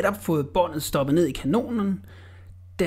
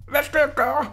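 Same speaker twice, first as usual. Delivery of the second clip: frightened; 9 semitones higher than usual. Acted. Frightened Orla asks.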